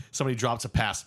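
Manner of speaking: ordinary talk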